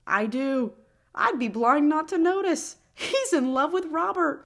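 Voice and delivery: high, loud dramatic reveal; excited reading. A narrator's reading. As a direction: build the reveal with big energy. Dramatic reveal read aloud.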